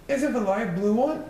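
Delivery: mother asking about the car colour; mother's voice. Acted. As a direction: ask brightly as the mother. Missus Popsnuggle asks.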